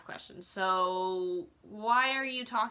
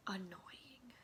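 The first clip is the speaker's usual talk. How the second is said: whispered; 15 dB quieter than usual. Real, not acted. Trailing complaint about Cara.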